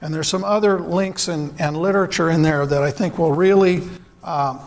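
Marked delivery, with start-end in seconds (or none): none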